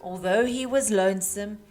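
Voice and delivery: plain reading voice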